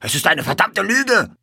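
high, loud angry outburst; outraged yelling; furious shout. A narrator's reading. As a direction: roar back at the accusation. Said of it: "schrie er".

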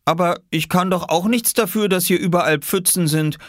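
put-on high child's voice making excuses; high-pitched childish excuse; boy protesting. A narrator's reading. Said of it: "murmelte Oskar".

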